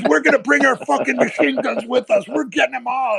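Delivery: high, worked-up imitation of a raging trader; retold furious threat. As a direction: rant as if rallying troops for revenge. Jimmy's rampage continues.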